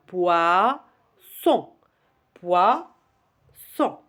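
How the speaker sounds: POISSON split into poi, then son; teacher's two-beat poisson, each syllable separate. Shown as a demonstration. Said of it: "Vous allez taper les syllabes".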